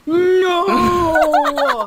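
shocked, loud high No!. Real, not acted. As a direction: cry out in disbelief. Disbelief at removed items.

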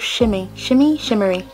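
"shimmy? Shimmy, shimmery", fumbling for the word shimmer; stumbling over shimmer. Real, not acted. Vlogger failing to say shimmer, stumbles through "shimmy, shimmery". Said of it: "cannot for the life of me say shimmer".

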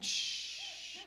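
long drawn-out "shh"; cooking noise, a sustained shh; imitated sizzle. Shown as a demonstration. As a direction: make a long, soft sizzling hiss.

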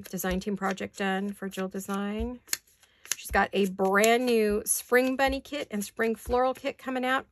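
ordinary talk, nothing marked

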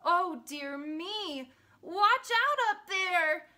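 storybook dog calling out a warning; alarmed cry. A narrator's reading. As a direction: cry out slowly, building to a loud call. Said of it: "good Trevor did cry".

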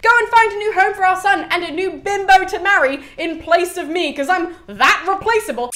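high, loud mock-cheerful sarcasm; hammed-up wife sending her husband off; sarcastic. Creusa supposedly tells him to remarry, bitterly sarcastic.

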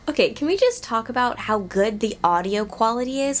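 ordinary delivery

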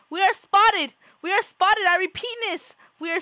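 high, loud playful panic; mock alarm. Spotted by the pet again, hammed-up alarmed yelling. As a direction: shout it like a spy radioing base.

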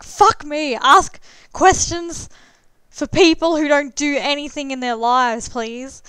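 loud, high mock rant, easing off on the last word; playful retold exasperation, blurted loudly; exasperated outburst. Retold exasperation at pointless questions.

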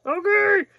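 high, loud squeal of anticipation; excited squeal. Real, not acted. Excited squeal before opening.

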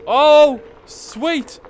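high, loud whoop of delight; surprised cheer on the slide; delighted surprise. Real, not acted. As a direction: yelp with joy. Sliding down the hill.

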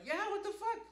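high, loud indignant yelp; startled high shout from inside the car; mock outraged shout. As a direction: yell it out the car window at the robot car. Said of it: "the person inside the car was like".

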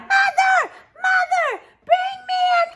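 very high, loud desperate call; Jack calling out. Acted. Jack calls down to his mother for the axe, desperate. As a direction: yell for help from the beanstalk. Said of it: "Jack called out".

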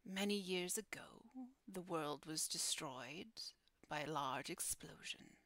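hushed, half-whispered guardian voice with long pauses; solemn guardian. Acted. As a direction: speak as a solemn keeper of dark stories. Guardian recounts the building's fall.

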